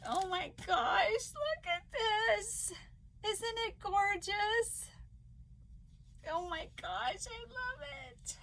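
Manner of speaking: high, slow, tearful wonder; real happy crying. Real, not acted. Vlogger showing a gift to viewers, crying with joy. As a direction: marvel through tears, voice climbing high. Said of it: "Thought I wasn't gonna cry".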